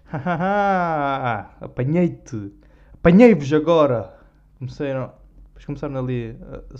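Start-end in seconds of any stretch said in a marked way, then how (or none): 0.0-4.1 loud, gleeful gotcha with a cackle; gloating laugh. Retold or imitated. Comedian gloating at the audience, mock triumphant laugh. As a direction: crow over the crowd like you caught them. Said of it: "retold gloating on stage".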